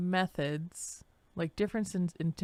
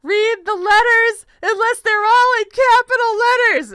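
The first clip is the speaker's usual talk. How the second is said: a caps-lock texter wailing in a put-on voice; whiny high shouting voice; 17 dB louder than usual. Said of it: "And then the person's like".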